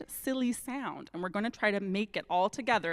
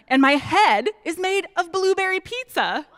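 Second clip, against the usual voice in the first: silly voice; 8 semitones higher, 6 dB louder than usual. Pretend. Forced silly reading, put-on voice.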